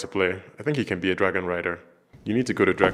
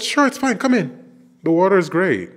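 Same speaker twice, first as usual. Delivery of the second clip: much higher, innocent lying voice; high sweet fake welcome; 9 semitones higher, 4 dB louder than usual. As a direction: lure them in sweetly, pitched up high. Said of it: "He said".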